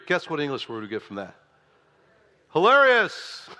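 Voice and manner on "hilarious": high, loud, drawn-out burst; loud exclamation. Real, not acted. Preacher reveals the English word, exclaiming loudly. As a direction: proclaim it big and high.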